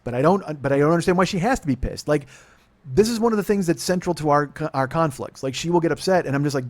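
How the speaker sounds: ordinary talk, nothing marked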